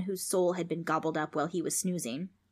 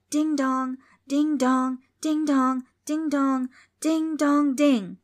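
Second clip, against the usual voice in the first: loud, high sing-song chime; imitated doorbell; 7 semitones higher, 6 dB louder, much slower than usual. A narrator's reading. Doorbell rung repeatedly by demons, insistent chiming. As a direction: ring it out insistently, over and over. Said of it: "The doorbell rang over and over".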